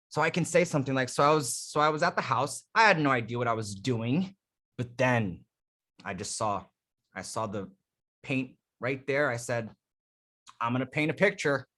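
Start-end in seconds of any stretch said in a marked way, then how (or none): 1.1-11.7 rushed start, then slow hushed pauses; dramatic pacing. Shown as a demonstration. Coach tells a made-up paint story, dramatic pacing. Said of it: "speaking faster or slower strategically".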